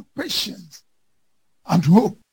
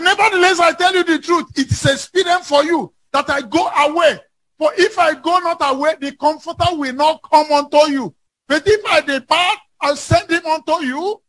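the verse cried out high and loud; preaching; 8 semitones higher, 3 dB louder than usual. Shown as a demonstration. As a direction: proclaim the scripture at full force. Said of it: "He said".

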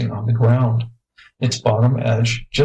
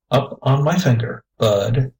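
usual speaking voice

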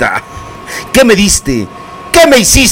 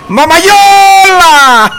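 long high stretched shout of Mamayola; street shout; 13 semitones higher, 4 dB louder, much slower than usual. Shown as a demonstration. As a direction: yell it like spotting her across the street. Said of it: "le voy a gritar así". (grito largo y agudo en la calle)

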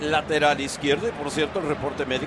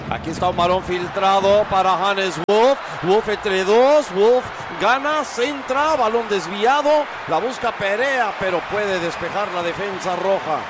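high, urgent attack call; loud, raised soccer commentator voice; excited play-by-play; 4 semitones higher, 4 dB louder than usual. Real, not acted. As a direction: build excitement as the attack develops. Live play-by-play.

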